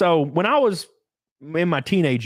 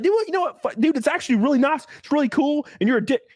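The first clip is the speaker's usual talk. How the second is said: higher-pitched retold irritation; mock annoyed; 8 semitones higher than usual. Teen defending NASCAR with mock irritation. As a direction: play the touchy teen getting defensive. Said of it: "I would get pissed".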